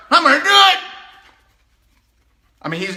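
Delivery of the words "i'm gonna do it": high, full-volume imitation of angry resolve; acted furious outburst; angry shout. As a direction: yell the decision like he's fired up. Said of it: "he was angry".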